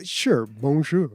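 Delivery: slow, smug mock French; mock bonjour. Answering the French request, a slow smug bonjour. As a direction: drag out the bonjour for a laugh.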